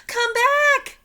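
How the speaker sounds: very high loud shout. A narrator's reading. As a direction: scream at him to come back. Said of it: "Annie shouted".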